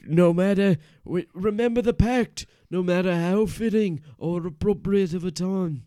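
loud, high, emphatic protest; heated scolding. Acted. Reminding Paul of their pact, loud high scolding. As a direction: shout down your bandmate over a broken promise. Acted quarrel over the secret pact.

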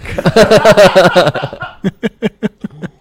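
big burst of real laughter; loud laugh. Real, not acted. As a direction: laugh out hard and high. Laughs at his own sound.

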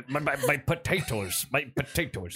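halting, garbled attempt at Irish; botched Irish accent. Shown as a demonstration. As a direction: fumble POTATOES in a rambling fake Irish accent. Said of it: "I can't".

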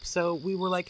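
usual speaking voice

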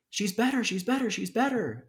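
imitating a little girl bursting with joy; excited child. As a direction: squeal it like a thrilled kid with big news.

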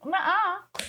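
high teasing nuh-uh; playful denial. Real, not acted. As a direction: refuse coyly, singsong.

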